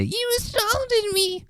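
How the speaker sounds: very high, slow, whiny mock of the woman; mocking the Karen. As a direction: squeal it like a faked victim. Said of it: "now she's gonna say".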